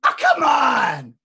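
slow, loud protest; loud, slow and exasperated; loud exasperated cry. Retold or imitated. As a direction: let out a drawn-out complaint. Angry protest retold.

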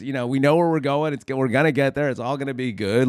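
usual speaking voice

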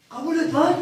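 insist, raising the voice on lan. Retold or imitated.

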